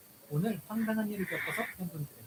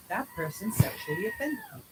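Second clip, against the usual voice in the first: retold scream; 11 semitones higher, 7 dB louder than usual. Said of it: "and screams".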